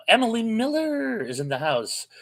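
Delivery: high happy announcement; excited blurt. Real, not acted. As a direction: blurt it out, thrilled to see her. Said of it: "when I blurted that out".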